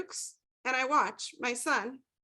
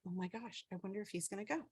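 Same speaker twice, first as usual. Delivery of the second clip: much softer, murmured to herself; hushed worried thought; 5 semitones lower, 13 dB quieter, faster than usual. Retold or imitated. Mother wondering whether her son will ride, hushed. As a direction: keep it under your breath, worried.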